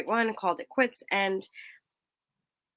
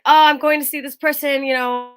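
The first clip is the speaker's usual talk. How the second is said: breezy; 8 semitones higher, 9 dB louder than usual. Retold or imitated. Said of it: "And I'm like".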